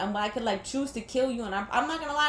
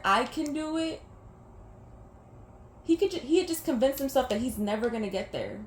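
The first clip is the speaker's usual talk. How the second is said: ordinary delivery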